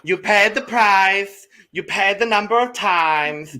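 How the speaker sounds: loud, high, slow declaiming; mock ad slogan. Riffing on an advert with co-hosts, slow loud mock slogan. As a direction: draw it out like a grand slogan.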